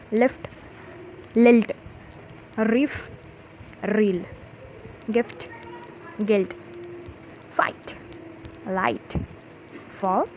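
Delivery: isolated words, each called out firmly with a pause after; word drill for repeating. Shown as a demonstration. Teacher has pupils repeat rhyming words, spaced drill delivery. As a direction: say each word distinctly, leaving room to echo.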